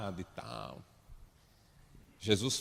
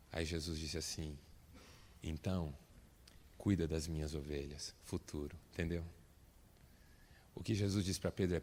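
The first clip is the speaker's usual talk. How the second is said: soft, low, gentle voice; tender question; 5 semitones lower, 8 dB quieter than usual. Acted. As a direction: ask quietly and kindly. Jesus gently asks Peter.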